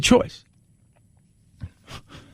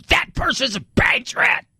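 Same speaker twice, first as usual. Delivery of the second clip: higher and slow, a put-on voice; mocking caller voice; 7 semitones higher, slower than usual. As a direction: poke fun at the caller's tone.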